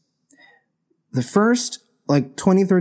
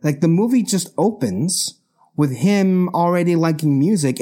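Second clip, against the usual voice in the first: ordinary delivery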